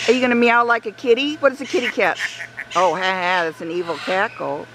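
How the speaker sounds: normal talking voice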